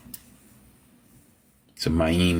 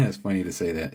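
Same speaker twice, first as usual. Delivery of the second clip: normal talking voice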